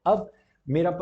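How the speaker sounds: ordinary talk, nothing marked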